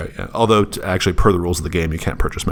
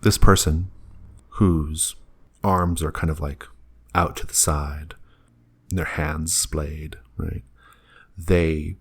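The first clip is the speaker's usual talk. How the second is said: hushed narration; 4 dB quieter than usual. Acted. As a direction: draw them in quietly. GM describes the floating figure.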